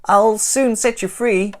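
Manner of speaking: high loud promise; reassuring Stickman. Acted. Stickman promising Santa rescue, high and loud.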